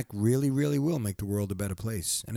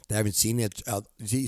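ordinary talk, nothing marked

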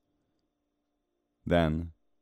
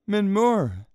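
high, loud cry of recognition; delighted exclamation; 9 dB louder than usual. Acted. Girl spots her cat, loud high delighted exclamation. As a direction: call the cat's name out high and loud. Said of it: "she exclaims".

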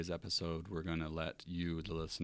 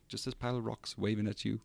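higher-pitched New Zealand accent impression; Korg; 5 semitones higher than usual. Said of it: "could do the New Zealand accent".

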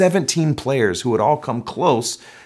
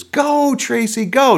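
much higher, louder cheering; excited cheer; 6 dB louder, slower than usual. Retold or imitated. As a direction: shout from the bleachers. Adults falling out of bleachers.

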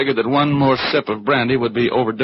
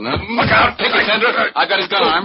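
high, loud yells mid-struggle; urgent shout; 12 semitones higher, 6 dB louder than usual. Acted. Detective yells to Sandra while wrestling Wood's gun arm, loud and urgent. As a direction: shout the warning while grabbing his arm.

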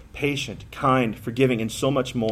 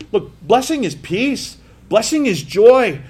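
slow, higher, ringing preacher's build; preaching at full force; 8 semitones higher, 4 dB louder than usual. Acted. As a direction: proclaim each line to the congregation. Preaching climax on blessing.

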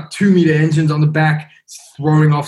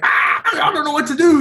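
much higher mock panic; a flustered deckhand at a loss; panicked Russian crew. Russian crew shining spotlights, higher mock panic. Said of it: "shouting in Russian".

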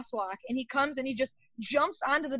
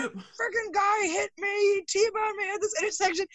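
imitated upset caller; 8 semitones higher than usual. Angry caller complaining on the phone, mock outrage. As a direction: lay it on like a wronged driver.

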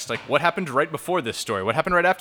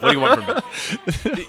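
higher, laughing mock protest; laughing exasperation; 7 semitones higher, 5 dB louder, faster than usual. Real, not acted. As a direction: throw up your hands, laughing. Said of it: "I'm making this up".

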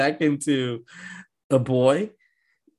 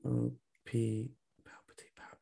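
hushed whisper, much softer; 2 semitones lower, 14 dB quieter than usual. Pretend. Host repeating the witch's power nickname, mock-dramatic whisper.

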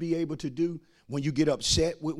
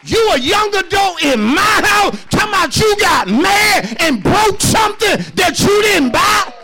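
high, loud, slowed preaching cry; full-force preaching; 10 dB louder than usual. Acted. Pastor preaching to parents, in full loud cry. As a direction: preach it hard to the church, lifting the voice.

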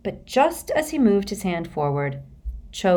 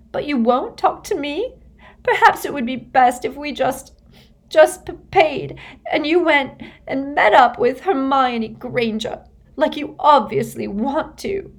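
much higher, louder, choking up; tearful and shrill; 9 semitones higher, 4 dB louder than usual. A narrator's reading. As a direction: sob out the accusation. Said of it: "her face now shining with tears".

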